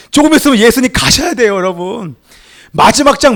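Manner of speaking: ordinary delivery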